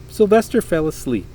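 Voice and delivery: plain reading voice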